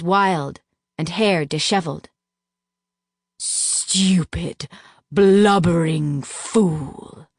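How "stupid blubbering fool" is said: slow, contemptuous hiss; hissed insult. A narrator's reading. Duke hissing at captive narrator, slow contempt. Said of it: "he hissed".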